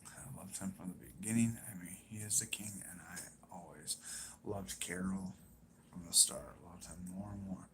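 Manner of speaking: very soft, whispery reading to oneself; under-breath reading. Real, not acted.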